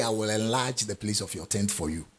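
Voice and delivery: quiet, offhand reading of the verse the wrong way; mock wrong reading. Shown as a demonstration. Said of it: "He didn't say".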